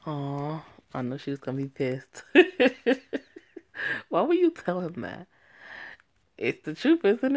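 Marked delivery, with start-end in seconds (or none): 2.3-4.0 high delighted cackle; real amused laugh; loud laugh. Real, not acted. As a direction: burst out laughing. Laughing at the reveal.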